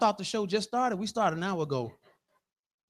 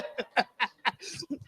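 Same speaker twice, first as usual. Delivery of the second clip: softer run of staccato laughter; chuckling laugh; 9 dB quieter than usual. Acted. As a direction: laugh on in short, separate bursts. Laughs on through the bit.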